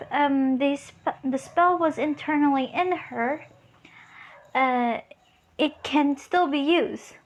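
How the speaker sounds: usual speaking voice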